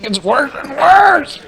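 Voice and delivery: slow, high strained yell; pained shout. Real, not acted. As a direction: drag it out in pain. Spicy bean pain.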